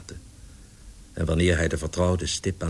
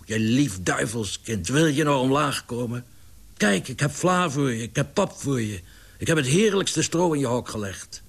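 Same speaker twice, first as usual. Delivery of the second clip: higher, wheedling entreaty; pleading; 6 semitones higher than usual. A narrator's reading. Larry begging his pigeon to come down to eat, pleading. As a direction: coax and beg with tempting offers. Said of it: "richtte hij smeekbeden tot de vogel".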